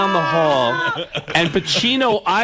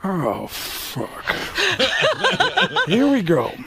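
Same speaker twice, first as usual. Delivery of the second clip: lightly voiced weary impression of a famous actor; drawn-out, put-upon groan of a line; slow, resigned celebrity impression; Al Pacino; 7 semitones higher, much slower than usual. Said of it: "Pacino looked and went".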